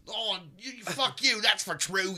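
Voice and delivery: loud, very high rough British yell; hillbilly British. Shown as a demonstration. Showing guests two kinds of British, loud hillbilly British accent. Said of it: "Then you have your hillbilly British".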